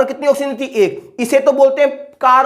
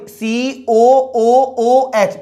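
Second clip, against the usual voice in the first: each letter of सीओओओएच stretched very slowly; drawn-out dictation; much slower than usual. Shown as a demonstration. Teacher dictating the formula to students, very slowly drawn out. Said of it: "teacher drawing out formula letters".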